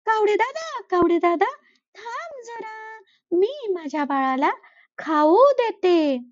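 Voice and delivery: sweet and busy, put him off gently; busy mother sparrow. Acted. Sparrow answers the crow while bathing her baby, high and sweet.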